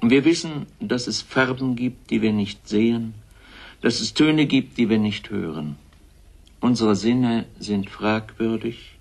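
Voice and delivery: normal talking voice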